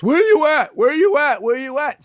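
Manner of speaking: high, loud hollering giant; dumb Cyclops calling. Acted. Blinded Cyclops hunts for Odysseus, high loud calling. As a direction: holler out three times, searching. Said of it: "Polyphemus is so dumb".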